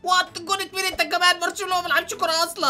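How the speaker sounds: can't believe what he just heard; shocked disbelief. Real, not acted. The host reacts to his friend's football claim, loud and incredulous.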